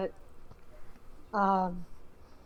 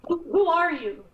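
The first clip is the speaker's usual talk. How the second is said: higher, stammering mock panic; freaking out; 5 semitones higher, 5 dB louder than usual. Startled character calling for the guard, freaking out. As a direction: call out in a flustered, acted alarm. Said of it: "start freaking out".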